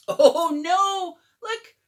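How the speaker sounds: alarmed. Real, not acted. Alarm at airplane.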